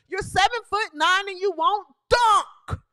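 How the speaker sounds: ordinary talk, nothing marked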